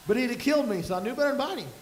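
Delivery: ordinary delivery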